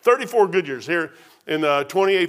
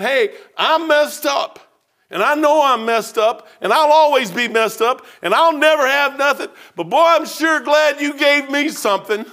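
raised, emphatic testimony voice; fervent confession; 6 semitones higher, 4 dB louder than usual. Retold or imitated. Man confessing to God on his back porch, fervent. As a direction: proclaim it high and loud, leaning on the key words.

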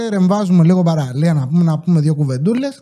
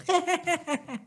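short high giggle, breathy and trailing off; a quick real laugh at the silly sound; γέλιο; 10 semitones higher, 11 dB quieter than usual. Real, not acted. Laughs after the button sound. (σύντομο αυθόρμητο γέλιο)

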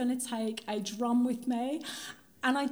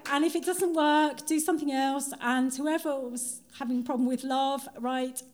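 normal talking voice

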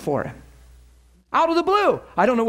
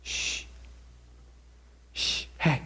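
hushed psst and shh; stage whisper; 6 semitones lower, 13 dB quieter, slower than usual. Retold or imitated. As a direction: call him over in a whisper. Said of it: "Holy Spirit's going".

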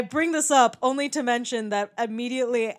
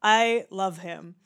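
ordinary delivery

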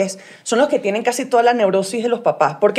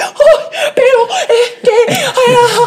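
higher, slow, laughing whine of a panicked new parent; mock fretful parent; 10 semitones higher, 3 dB louder, slower than usual. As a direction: wail like a new parent fussing over the baby, half laughing. Mocking anxious first-time parent.

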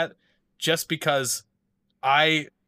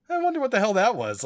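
puzzled; 11 semitones higher, 4 dB louder than usual. Retold or imitated. Hearing a strange pop.

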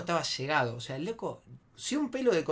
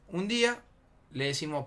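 ordinary delivery, nothing marked

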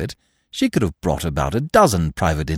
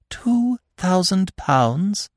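slow, dazed, high question; stunned, drawn-out repeat; dizzy disbelief; 11 semitones higher, much slower than usual. A narrator's reading. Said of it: "she repeated dizzily".

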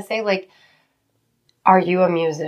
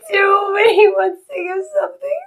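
high, teary and shaky with emotion; near tears; 14 semitones higher than usual. Real, not acted. Retelling the drummer boy story, fighting real tears. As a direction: hold back tears as you speak. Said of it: "I'm literally trying not to cry".

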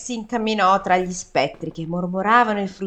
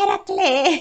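higher ghostly call of the name; 7 semitones higher, slower than usual. Pretend. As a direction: call him from the dark. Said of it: "anche lui probabilmente". (spettro che chiama Eracle)

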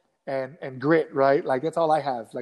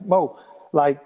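mock mad scolding; 2 semitones higher, 6 dB louder than usual. Sparring partner teaching Mo a lesson, imitated mad scolding. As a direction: imitate a teammate who always sounds mad. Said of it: "He talks like he's always mad".

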